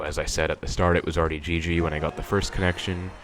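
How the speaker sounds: usual speaking voice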